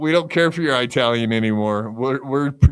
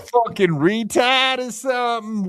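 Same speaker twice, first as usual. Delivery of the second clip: higher, mock-Boston jeering; Boston accent; 7 semitones higher than usual. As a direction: put on a hammed-up Boston accent. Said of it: "the Boston lady accent".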